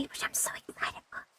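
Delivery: softly whispered stream plan; excited whisper. Real, not acted. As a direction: whisper it like a secret treat.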